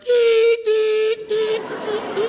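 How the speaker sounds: very high electronic beeping; baby antenna beeping. Retold or imitated. Every mom's baby antenna going off, high beeping. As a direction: sound like an alarm going off. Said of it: "baby antenna ... It's like".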